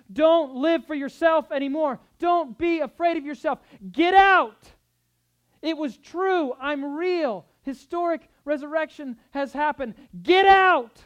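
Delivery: high, booming preacher's shout; shouted command. Acted. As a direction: bellow it as an urgent charge to followers. Preacher voices Jesus commanding the disciples.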